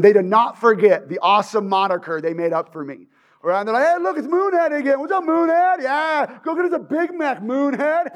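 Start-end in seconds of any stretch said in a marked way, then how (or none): 3.9-8.1 higher, sing-song mockery; mock him for the crowd; taunting bully. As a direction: bullies jeering at the kid as he walks past, mocking. Bullies taunting him again.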